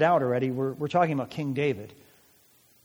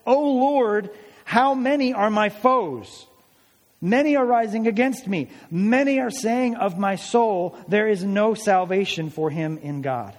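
scripture reading; 5 semitones higher, 4 dB louder than usual. Acted. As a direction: read it out like a lament, lifted high. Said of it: "So Psalm three verse one says this".

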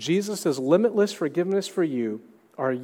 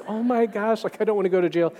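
higher, mock-panicked child's protest; retold freak-out; 6 semitones higher than usual. Seven-year-old answering mom's jail threat, mock freaked out. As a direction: imitate a kid dreading jail, pitched up. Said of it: "totally freaked out".

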